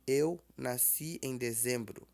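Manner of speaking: ordinary delivery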